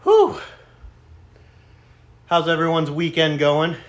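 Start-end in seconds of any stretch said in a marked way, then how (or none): 0.0-0.4 loud high exhale of effort. Real, not acted. Exhale after lifting.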